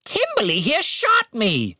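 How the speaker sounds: high, slow bird squawk; accusing raven. A narrator's reading. Raven tells Catherine who shot it, accusing squawk. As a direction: tell on him as a bird.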